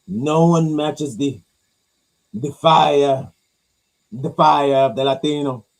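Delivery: put-on dramatic Latin accent, slow and loud; fake Latin accent. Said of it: "me doing somebody who's doing a Latin accent".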